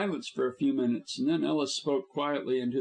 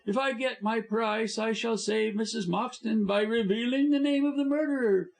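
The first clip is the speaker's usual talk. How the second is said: high contemptuous sneer; sneering villain; 9 semitones higher than usual. A narrator's reading. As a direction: sneer down at them. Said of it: "sneered Busham".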